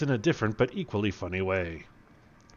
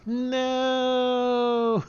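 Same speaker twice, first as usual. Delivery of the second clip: high, loud dramatic "No!"; high cry; 14 semitones higher, 3 dB louder than usual. Acted. Slavo diving in front of the bullets, a high cry.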